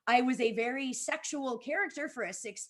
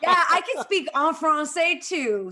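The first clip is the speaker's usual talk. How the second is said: put-on French accent; 4 semitones higher, 7 dB louder than usual. Still in French voice, laughing.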